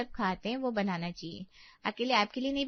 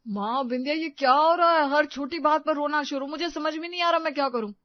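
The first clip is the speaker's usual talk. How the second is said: raised, loud frustrated appeal; anger and despair; 6 semitones higher, 6 dB louder than usual. Acted. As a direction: burst out at wits' end, pitch up. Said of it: "उसकी आवाज में गुस्सा और हताशा दोनों थे". (गुस्से और हताशा से भरी आवाज़)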